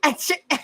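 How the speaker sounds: high-pitched real laugh; short, high burst of laughter. Real, not acted. Reacts to partner's cockney joke.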